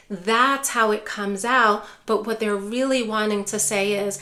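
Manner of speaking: normal talking voice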